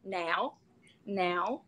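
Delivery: "Now" softly, picked up at the end; southern NOW. Shown as a demonstration. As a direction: say a quiet "Now" the southern Irish way. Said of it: "you gotta pick it up at the end".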